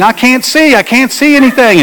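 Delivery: a mild mock yell of fright; retold yell. Friend at the helm crying out in the storm, high mock yell. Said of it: "she's yelling".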